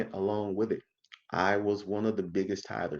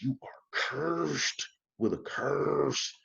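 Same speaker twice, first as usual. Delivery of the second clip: very slow, drawn-out preacher's growl, pitched up; 4 semitones higher, 4 dB quieter, much slower than usual. Retold or imitated. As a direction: imitate a preacher growling out a curse. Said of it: "they get deep growling with it".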